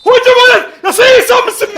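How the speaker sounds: screaming high in disbelief; outraged shout. Real, not acted. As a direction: yell out in fury. Opponent scores, outraged.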